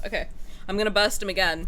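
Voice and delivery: ordinary delivery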